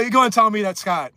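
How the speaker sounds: ordinary talk, nothing marked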